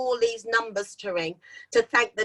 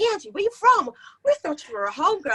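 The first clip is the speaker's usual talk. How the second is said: high excited American radio host; 4 semitones higher, faster than usual. Retold or imitated. Radio DJs surprised she is British, high excited American voice. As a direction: hype it up like a US DJ. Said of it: "they went".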